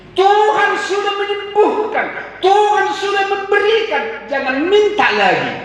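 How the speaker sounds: high, loud proclamation with a laugh; full-force preaching. Acted. Preacher proclaiming God already healed, high and loud.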